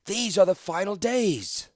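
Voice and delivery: high, slow, ringing proclamation; stately orator at full voice; loud declaration. A narrator's reading. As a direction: declare it to the whole crowd. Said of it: "Latilla declared".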